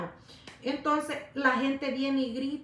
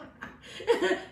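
high breathy laugh; 8 semitones higher than usual. Real, not acted. Laughing at her own remark.

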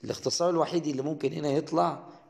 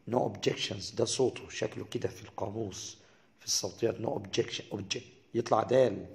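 ordinary talk

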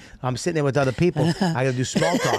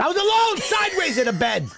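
mock dramatic wail. Mock dramatic complaint, voice jumps.

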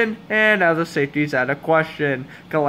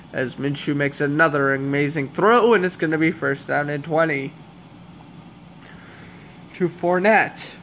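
usual speaking voice

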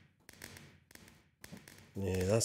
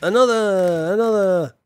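louder, higher chant of another; cheering for one more firework; excited call; 5 semitones higher, 7 dB louder than usual. Real, not acted.

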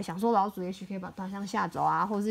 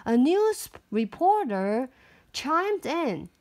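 ordinary delivery

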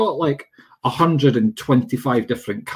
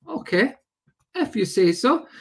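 mock compliance; 9 semitones higher than usual. Acts own reply to moaners.

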